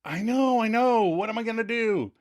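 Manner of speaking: high-pitched fretful mock whine; mock panic. Joey to Ross with time running out, panicky. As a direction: fret helplessly in a high voice. Acted fretting reply under time pressure.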